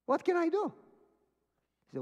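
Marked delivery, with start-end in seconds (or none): 0.1-0.7 high, loud mock desperation; exasperated cry. Retold distressed exclamation.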